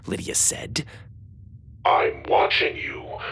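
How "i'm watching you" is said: slow, menacing robot voice. A narrator's reading. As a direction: threaten them slowly. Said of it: "in a menacing tone".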